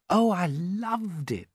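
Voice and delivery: put-on a touch of boyish wonder, drawn out; lightly voiced admiring boy. A narrator's reading. James praising the grasshopper's music, lightly delighted.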